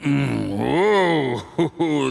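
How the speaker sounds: ordinary delivery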